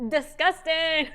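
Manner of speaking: laughing. Real, not acted. Laughing at talking with mouthful.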